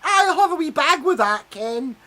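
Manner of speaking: high, loud, hammed-up Fife accent; bad Fife accent. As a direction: overdo a fake Fife accent, laughing. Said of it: "in the shop they go".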